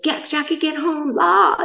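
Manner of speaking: high nagging mock of the husband; lightly mimicked nagging husband. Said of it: "He would get".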